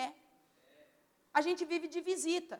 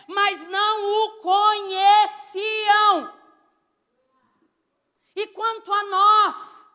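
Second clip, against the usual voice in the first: loud, higher-pitched preaching, stretching key words; pregação inflamada; 6 semitones higher, 11 dB louder, slower than usual. Acted. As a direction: preach at full force, building to drawn-out climaxes. Preaches at full force. (pregação em voz alta e exaltada)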